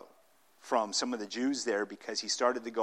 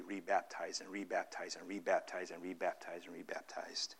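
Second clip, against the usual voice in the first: whisper; 5 semitones lower, 8 dB quieter than usual. Real, not acted. Listing endless rebaptisms, trailing off.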